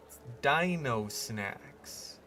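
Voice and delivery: drawn out and pointed, Dino leaned on; slow, pointed. Pretend. Rebranding popcorn for park guests, slow and pointed. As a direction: spell out the fake product name slowly. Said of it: "Wink, wink".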